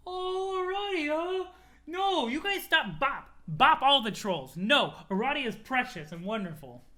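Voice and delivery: high drawn-out sympathy turning loud; protective cooing. Real, not acted. Reader defending precious Aradia, high protective outcry. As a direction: coo high, then defend them slowly.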